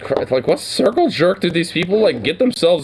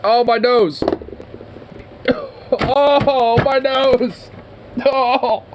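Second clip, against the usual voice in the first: laughing hard; 10 semitones higher, much slower than usual. Real, not acted. Cracking up at what Charlie just said, high laughing. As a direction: crack up and squeal the words through laughter.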